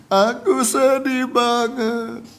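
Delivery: hammed-up drawn-out wail, high; mock weeping. Preacher guessing the fire victim's words to congregation, mock weeping. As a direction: wail it slowly, pretending to be the tearful victim. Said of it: "dia menangis".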